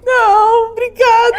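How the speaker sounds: loud, high mock-weepy wail; retold tearful refusal. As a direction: decline as if still bawling. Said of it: "comecei a chorar de novo". (recusa chorosa e alta, imitando)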